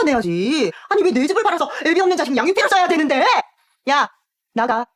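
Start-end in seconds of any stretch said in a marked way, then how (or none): 0.9-3.2 rising, louder indignant scolding; angry outburst. Acted. Aunt scolding her niece, indignant and loud.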